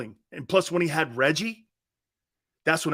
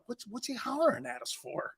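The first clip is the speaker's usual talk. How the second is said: soft, higher, puzzled murmur; bewildered aside; 5 semitones higher, 9 dB quieter than usual. Retold or imitated. Player to teammate about the hollering coach, hushed and bewildered. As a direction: ask it under your breath, baffled.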